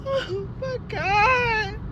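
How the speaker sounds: ordinary talk, nothing marked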